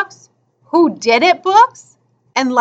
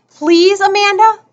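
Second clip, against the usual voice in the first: higher, insistent plea by name; pleading; 6 semitones higher, 4 dB louder than usual. Acted. Boy begging Amanda to lend a book, pleading. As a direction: beg her by name, voice lifted.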